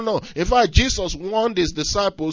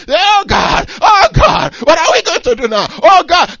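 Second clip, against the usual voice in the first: high, loud pleading wail to God; mock desperate crying out to God; 9 semitones higher, 7 dB louder than usual. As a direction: cry out like a panicked crowd.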